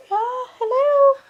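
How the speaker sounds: high, slow mock phone greeting; playful phone hello. Real, not acted. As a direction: answer a pretend call in a high sweet voice. Mom answering toy phone playfully.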